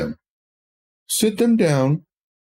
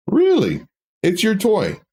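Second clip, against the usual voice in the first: mock surprise; 6 semitones higher, slower than usual. Parent mock-surprised at child.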